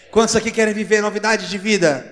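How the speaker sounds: loud, high, insistent call; forceful rallying cry. Real, not acted. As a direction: push the question out to rouse the congregation. Repeats the rallying question more forcefully.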